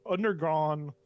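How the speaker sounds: ordinary talk, nothing marked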